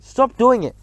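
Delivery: high, loud demand to stop; urgent plea. A narrator's reading. Narrator ordering Bruno to stop, high and urgent. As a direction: order him to quit.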